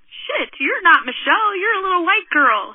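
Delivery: imitated startled realization; taken aback. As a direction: blurt it as the stranger seeing his mistake. Stranger realizes his mistake, retold.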